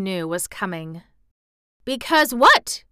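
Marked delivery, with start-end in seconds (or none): 1.8-2.8 high, loud, drawn-out eager question; eager cry. A narrator's reading. Colin begs Mary to go on, eager cry. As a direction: cry out eagerly, pitched well up. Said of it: "he cried eagerly".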